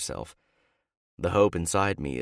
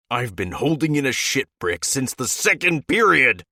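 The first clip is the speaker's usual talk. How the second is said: higher, much louder angry yell; 7 semitones higher, 8 dB louder than usual. A narrator's reading. Teammate storms in, yelling angrily at him. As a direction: let him have it loudly. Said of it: "Ben stomps into the bathroom, glaring".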